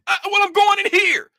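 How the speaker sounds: high-pitched, heated retold protest; defiant insistence. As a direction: re-enact digging your heels in, pitch jumping up. Retold argument with the official.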